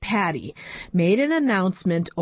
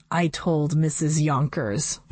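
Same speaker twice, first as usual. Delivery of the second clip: ordinary delivery, nothing marked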